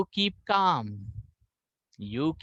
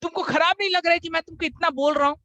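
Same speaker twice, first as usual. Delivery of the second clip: high, loud scolding father; imitating an exasperated father; angry father; 12 semitones higher, 4 dB louder than usual. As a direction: scold your son in disbelief. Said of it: "पापा बोल रहे".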